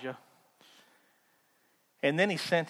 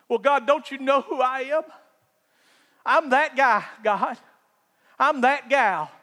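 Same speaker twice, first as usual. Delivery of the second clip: higher, louder hammed-up bragging to God; mock boasting; 9 semitones higher, 7 dB louder than usual. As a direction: puff yourself up, listing credentials. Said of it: "Have you ever done that".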